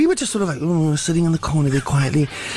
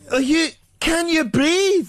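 slow, high Scottish voice; calm Scots brogue, slowly; Scottish accent; 12 semitones higher, slower than usual. Retold or imitated. Scottish guy voiced in story.

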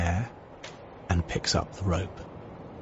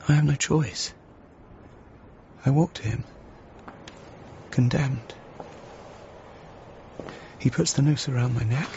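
terrified; 8 semitones higher than usual. Acted. Hanging scene, rising terror.

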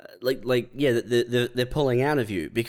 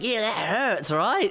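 higher, mocking dentist; hammed-up sadistic dentist; taunting dentist; 9 semitones higher, slower than usual. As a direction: needle the patient sweetly.

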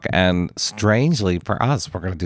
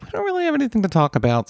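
high mock voice; 13 semitones higher, faster than usual. Retold own words, high mock voice.

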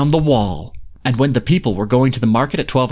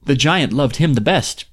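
ordinary delivery, nothing marked

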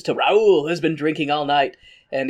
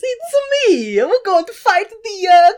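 put-on Mario-style Italian accent; 6 dB louder than usual. Gladiator greeting Spartacus in a laughing Mario-style Italian accent. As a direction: ham it up like Mario in Rome. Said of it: "sounded like Mario and Luigi".

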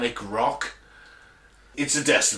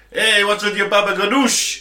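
put-on loud, higher New York Sopranos accent; mock New York accent; 6 semitones higher, 6 dB louder than usual. Quoting The Sopranos in a hammed-up New York accent. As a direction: bark it like a New York mobster.

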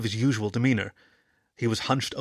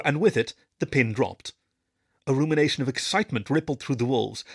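plain reading voice

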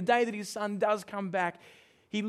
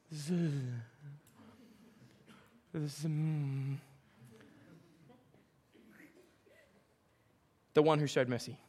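reluctant stammer; 5 semitones lower, 4 dB quieter than usual. Retold or imitated. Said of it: "He can't even say Samaritan".

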